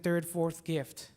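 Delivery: ordinary talk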